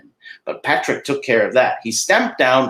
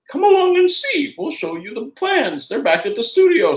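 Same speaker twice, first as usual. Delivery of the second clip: squeaky friendly cartoon mouse; Mickey Mouse falsetto. Mickey invites Patrick.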